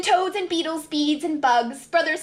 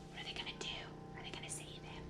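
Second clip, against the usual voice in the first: whispered question; 26 dB quieter, much faster than usual. Real, not acted. Reader whispering to listening children in suspense. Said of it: "whispered question to children".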